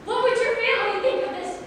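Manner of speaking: high, loud, slowed plea to stop; desperate shout. Acted. Reyna crying out to Piero to stop stabbing her, loud and high. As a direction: cry out to halt the attack. Queen pleads as Piero stabs.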